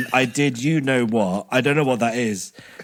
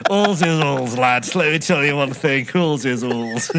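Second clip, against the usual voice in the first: matter-of-fact Yorkshire pronouncement; Yorkshire accent. Acted. Imparting one thing to the lads, Yorkshire accent. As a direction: lay down the law like a Yorkshireman. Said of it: "in a Yorkshire accent".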